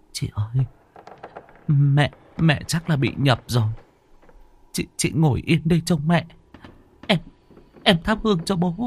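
high, stammering sister's voice; frightened younger sister. Acted. Sister tells sister mother is possessed, frightened stammer. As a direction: stumble over words in a high, scared voice.

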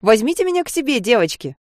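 high, eager cry; excited exclaim. Acted. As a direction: chirp it out like a delighted mum. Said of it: "обрадованно воскликнула".

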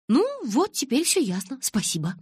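high, exaggerated sarcastic thanks. A narrator's reading. Narrator thanking Калин for clearing it up, sarcastic. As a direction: open high and loud, then drop to dry thanks. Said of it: "с сарказмом поблагодарила я".

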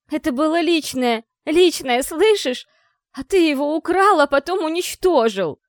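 громкий высокий яростный крик; кричи обвинение ему в лицо; furious shouting. A narrator's reading. (яростный крик)

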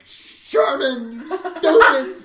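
high, drawn-out exasperated cry; angry scolding. Retold or imitated. Camper scolding friend after burning his hand, angry.

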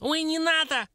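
very high, frantic squeal; squeaky, panicked little creature; squealing dwarf. A narrator's reading. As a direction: shriek for mercy like a tiny imp. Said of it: "заверещал тот".

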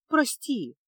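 dejected apology. A narrator's reading. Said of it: "уныло проговорила Феска".

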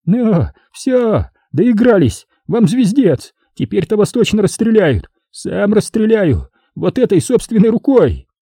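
high-pitched furious threat; high, agitated villain's threat; shrill threat. A narrator's reading. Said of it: "визгливый голос".

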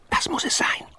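breathy whisper, barely voiced. A narrator's reading. Pitt whispering to Sven on seeing the ship. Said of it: "flüsterte Pitt".

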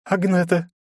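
put-on the girl gives her name, embarrassed; shy girl. A narrator's reading. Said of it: "смущённо ответила та". (смущённо)

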